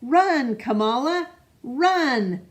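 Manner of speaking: loud, high call across the park; dad calling his daughter at the park, loud and high. Acted. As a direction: shout it out to urge the child to run. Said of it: "he'd let go of my hand and call out".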